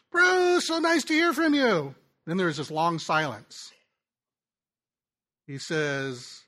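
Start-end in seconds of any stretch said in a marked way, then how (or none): 0.1-2.0 much higher, louder mock cheerful answer; the struggling student's forced cheerful phone reply; friend's cheery reply. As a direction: play the friend putting on a brave bright front.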